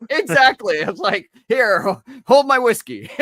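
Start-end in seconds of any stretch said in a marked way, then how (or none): 1.5-3.1 loud, high-pitched boast; mock bravado. Recalling own drunken recklessness to co-host, mock bravado. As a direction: toss it out high and loud, as a dare.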